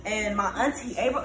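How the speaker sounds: ordinary talk, nothing marked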